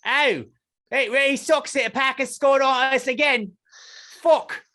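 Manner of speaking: high, loud hammed-up British moan; mock British accent. Fan moaning about a goal in mock British accent. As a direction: grumble like a gutted English fan.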